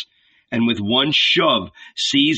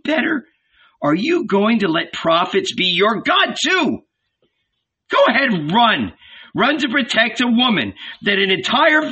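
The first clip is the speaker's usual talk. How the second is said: much higher, fierce reproach building to a shout; angry scolding mother; 10 semitones higher than usual. A narrator's reading. Old Salome berates her son Jacob, building to a shout. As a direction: shame your son with rising fury.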